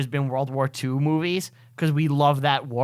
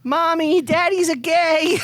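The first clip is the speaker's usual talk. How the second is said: put-on much higher little kid voice, the last part slowed; mock child voice; 13 semitones higher, 3 dB louder, slower than usual. Child at the table after the father's poem, in a much higher mock kid voice. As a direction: pipe up like a small child tattling to mom.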